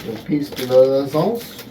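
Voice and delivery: put-on playful French accent on piece de resistance. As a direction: say it like a hammy French waiter. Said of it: "with my lousy French accent".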